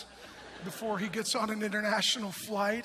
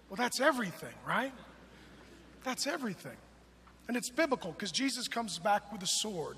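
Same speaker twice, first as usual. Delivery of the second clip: ordinary talk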